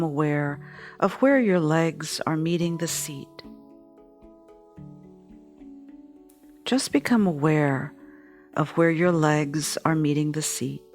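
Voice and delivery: calm, steady meditation guide; guided meditation. Acted. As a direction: lead them gently through the breathing. Leads a meditation.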